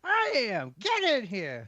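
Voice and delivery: high, drawn-out singsong invitation; high call. Acted. Bather inviting the visitor in through the door, high singsong. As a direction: call them in with a high, playful lilt.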